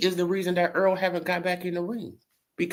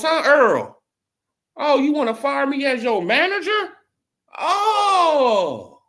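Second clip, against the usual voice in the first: higher, louder mocking jeer with a laugh; sneering taunt; 9 semitones higher, 4 dB louder, slower than usual. Vlogger as Haymon jeering at Earl, high and loud. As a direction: taunt him like a spiteful boss.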